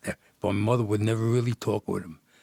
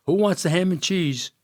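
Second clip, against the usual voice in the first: loud, higher call across the room; 7 semitones higher, 5 dB louder than usual. Retold or imitated. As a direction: shout it to the crowd. Caterer calling out at wedding.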